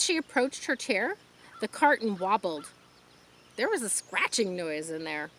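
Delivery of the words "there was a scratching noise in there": ordinary delivery, nothing marked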